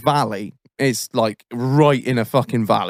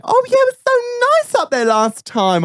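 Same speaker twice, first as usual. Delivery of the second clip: high, mocking impression of the wife; sky-high mocking wife voice. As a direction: squeak it out like a prissy wife. Mocking wife's voice.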